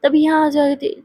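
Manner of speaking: ordinary delivery, nothing marked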